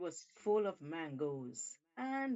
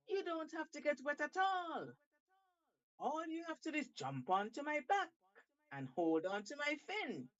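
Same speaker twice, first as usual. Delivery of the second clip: coaxing shark; 7 semitones higher than usual. Acted. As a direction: reel the monkey in with promises, pitched up. Shark coaxes monkey onto back.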